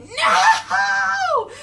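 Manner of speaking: high, loud, long mock wail; Raph's anguished cry. Raph falling to his knees, hammed-up high wail. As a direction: howl it out in melodramatic despair. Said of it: "falls to his knees and goes like".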